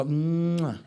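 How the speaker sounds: a smacking mock kiss; blown kiss sound. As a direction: blow a kiss at the angry driver. Blowing a kiss at driver.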